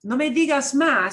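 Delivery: firm, final, conversation closed; dismissive. Shown as a demonstration. Teacher closing a topic, firm and final. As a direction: shut the topic down firmly. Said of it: "in that tone of voice".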